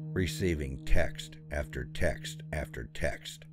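ordinary delivery, nothing marked

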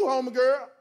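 ordinary talk, nothing marked

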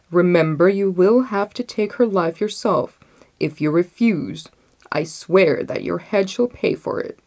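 loud, commanding royal warning; stern threat. A narrator's reading. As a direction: swear it like a ruthless king. Sultan threatens his vizier.

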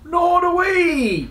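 very high, loud, drawn-out agreement; slow, high storybook wail; chorused cry. A narrator's reading. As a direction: call out like a chorus of knights. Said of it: "chorused the knights".

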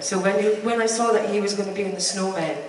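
usual speaking voice

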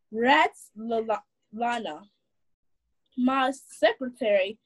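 ordinary delivery, nothing marked

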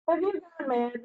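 mild bellow. A narrator's reading. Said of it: "Ron bellowed".

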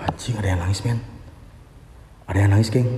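low, soft scared voice; quiet frightened murmur; hushed fear. Real, not acted. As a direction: report it low so the ghost won't hear. Hears someone crying, hushed.

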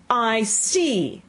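high, knowing cut-in; imperious queen. A narrator's reading. Queen cuts the gardener off.

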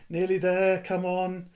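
high pleading coax; cajoling. A narrator's reading. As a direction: urge it gently onward. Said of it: "said Ron cajolingly".

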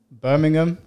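Brummie twang on one word; mock Birmingham accent. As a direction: say it like a Brummie, mocking. Saying accent he dislikes.

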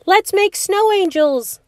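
put-on high excited boy's call; excited shout. A narrator's reading. Robbie at the window to Louise, excited. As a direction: call out the idea with glee. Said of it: "he shouted".

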